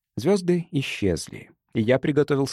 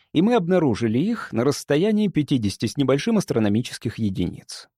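ordinary delivery, nothing marked

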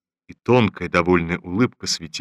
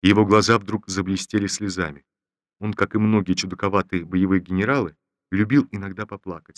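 ordinary delivery, nothing marked

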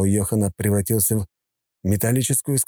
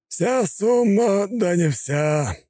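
high, very slow smirking tease; crooked smirk; 13 semitones higher, much slower than usual. A narrator's reading. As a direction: smirk through the words. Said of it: "Ника криво усмехнулся".

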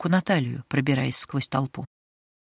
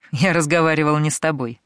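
smirking; 3 dB louder than usual. Acted. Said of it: "ухмыльнулся молодой торговец".

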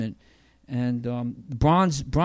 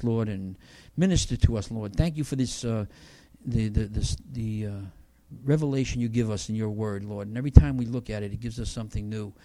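prayer; 3 semitones lower than usual. Acted. Closing prayer.

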